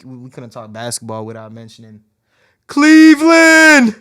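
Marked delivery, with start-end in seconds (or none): 2.7-3.9 drawn-out high yell; announcer-style hype call; hype shout. Acted.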